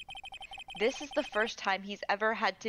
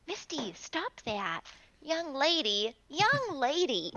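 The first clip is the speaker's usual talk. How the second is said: high, slow mock-stern scold; 5 semitones higher, slower than usual. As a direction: playfully scold a pet. Scolding the pet.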